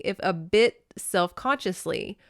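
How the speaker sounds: usual speaking voice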